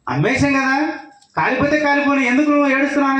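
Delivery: lightly voiced son brushing off his father's tears; voicing the eldest son. Eldest son chiding his weeping father, voice pitched high.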